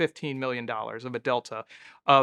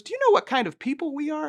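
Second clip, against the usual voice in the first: much higher and faster, mock threatening old lady; hammed-up widow warning, higher pitch; imitating a menacing older woman; 12 semitones higher, faster than usual. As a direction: rattle it off like a veiled threat from a matriarch. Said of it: "And she goes".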